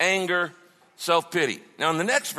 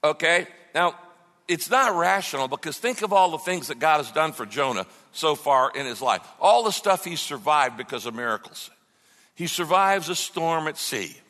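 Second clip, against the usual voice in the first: ordinary talk, nothing marked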